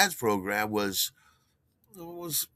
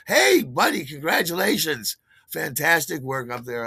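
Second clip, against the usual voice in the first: eager greeting; 4 semitones higher, 6 dB louder than usual. Retold or imitated.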